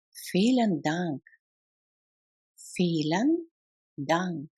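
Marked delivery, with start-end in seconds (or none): 2.6-4.5 slow, two words set apart. Shown as a demonstration. Teacher models thanks for learners, word by word. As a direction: pause between the two words. Language drill, separated take.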